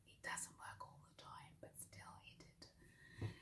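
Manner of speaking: hushed conspiratorial whisper; whispered aside. Real, not acted. Vlogger confiding to viewers about the bell, hushed whisper. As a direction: lean in and whisper it as a secret.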